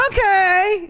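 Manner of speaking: very high squeaked okay; squeaky okay. Retold or imitated. Stripper giving in to the customer, high squeak. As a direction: squeak it out like a meek girl.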